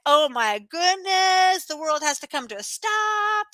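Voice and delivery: slow, stretched-out mock alarm; mock drama. Mom describing three-year-old's crying, drawn-out mock drama. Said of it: "When my three-year-old cries, though, it's like".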